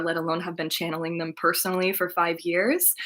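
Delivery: ordinary delivery, nothing marked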